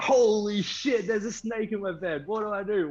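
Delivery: high, frantic panic at a snake in the bed; panicked yelp. Retold or imitated. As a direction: squeal it out like you just found a snake. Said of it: "And he's like".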